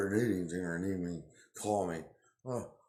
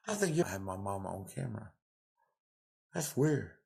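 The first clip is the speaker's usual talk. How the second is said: ordinary delivery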